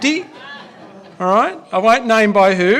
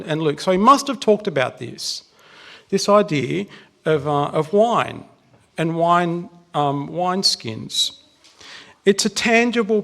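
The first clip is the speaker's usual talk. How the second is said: normal talking voice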